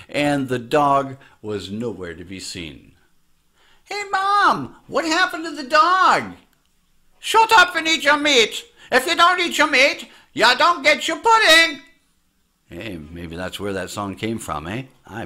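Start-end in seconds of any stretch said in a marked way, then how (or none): 3.8-6.3 put-on kid voice. Retold childhood question to mom. 7.2-11.8 angry mom voice. Retold or imitated. Mom voiced in retold story.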